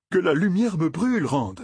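high, sudden exclamation; exclaiming. A narrator's reading. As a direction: cry it out in alarm. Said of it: "s'écria Matt". (s'écrier, voix haute et soudaine)

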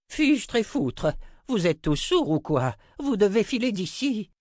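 put-on high-pitched cranky old wizard; exasperated outburst. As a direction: scold them all in exasperation. Old wizard swears at his rescuers.

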